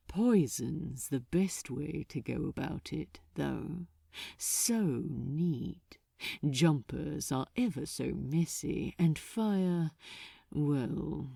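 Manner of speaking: lightly voiced, smug demon; low, pleased, unhurried; silky archdemon voice. A narrator's reading.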